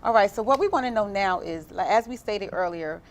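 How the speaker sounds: loud, upbeat host welcome back; show host intro. Acted. Host returns from the clip.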